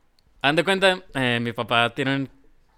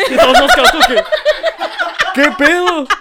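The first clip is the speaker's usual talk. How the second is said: laughing; 10 dB louder than usual. Retold or imitated. Stunned family reacting.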